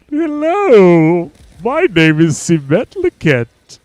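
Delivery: high, drawn-out character introduction; Simet Liket voice. Pretend. Streamer finding a voice for the name, high put-on voice. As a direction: try out a slow, high-swooping put-on voice. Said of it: "What's the voice to that name?".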